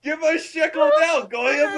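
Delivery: very high, loud cartoon yelling; caricature captor. Friend playing captors returning, high caricature voice. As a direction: burst in with a shrieked comic villain voice.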